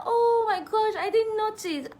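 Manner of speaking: high, loud mock astonishment; surprised realization. Other person realizes the song is English, imitated astonishment. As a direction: imitate a sudden, squealed realization. Retold realization after singing lyrics.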